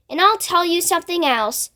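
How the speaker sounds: ordinary delivery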